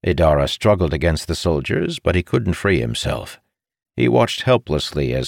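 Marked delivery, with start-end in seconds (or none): none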